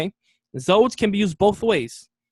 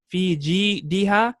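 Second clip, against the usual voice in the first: "fi jidiha" slowly, broken into syllables; slow model repeat; slower than usual. Shown as a demonstration. Teacher repeating the chapter's next word, slowly spacing out "fi jidiha". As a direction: separate and stretch "fi ji-diha" for learners.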